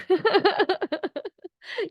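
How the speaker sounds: high-pitched delighted giggle; a bright, high little laugh. Acted.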